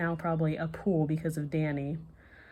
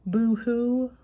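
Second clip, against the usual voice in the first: higher, louder sing-song boo-hoo; mock boo-hoo; 6 semitones higher, 6 dB louder, much slower than usual. A narrator's reading. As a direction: tease back in a mock cry. Amber gives in.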